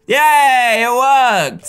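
cheering that the guests got in; excited cheer. Real, not acted. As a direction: celebrate it out loud. Guests finally enter house.

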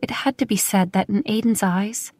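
plain reading voice

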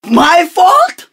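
affronted, can't believe it; indignant disbelief. Acted. Money minister protests the school minister's blame.

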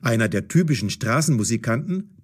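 ordinary delivery

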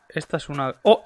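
ordinary talk, nothing marked

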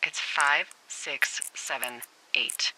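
exasperated slow repeat. Acted. To Henry who forgot the code, slow exasperated repeat. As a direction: drag it out like talking to a child.

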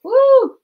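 high, loud whoop; excited woo. Real, not acted. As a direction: let out a delighted high "woo". Excited at cute craft finds.

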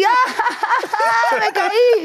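high, squealing mock laughter; drunken giddy cackle. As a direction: act out laughing drunk after falling. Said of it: "Nada, yo así".

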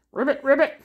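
croaking ribbit; frog ribbit. Shown as a demonstration. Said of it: "Frog sound".

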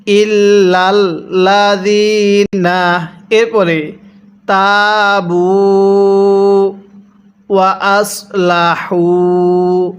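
ইল্লাল্লাযিনা তাবু ওয়া আসলাহু chanted slowly in sequence; slow joined recitation. Shown as a demonstration. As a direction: recite the phrase slowly together. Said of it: "তাহলে মিলাই পড়ুন".

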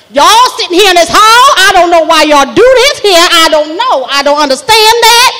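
loud, exasperated Southern woman; Miss Doreen. Acted. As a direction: scold the hall, no nonsense. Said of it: "She talk like that".

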